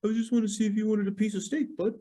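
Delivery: high, fast, innocent voice; innocent roommate. Retold or imitated. Imitating the roommate offering steak, high and quick. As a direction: sound sweetly harmless. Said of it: "He'd be like".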